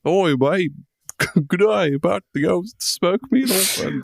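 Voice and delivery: hammed-up Australian mate; bad Australian accent. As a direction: attempt a jokey Aussie accent and fumble it. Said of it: "can't do a good Australian accent".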